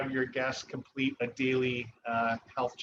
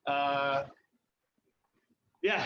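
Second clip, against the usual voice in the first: ordinary talk, nothing marked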